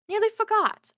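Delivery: high, startled remembering; sudden realization. Acted. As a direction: catch yourself, suddenly remembering.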